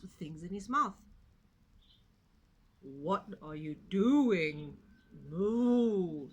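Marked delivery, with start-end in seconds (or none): 2.8-6.3 slow, loud cow with a moo. A narrator's reading. Cow asking Little Pig what he is doing, slow and mooing. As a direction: drawl it like a lazy cow. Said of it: "said the cow".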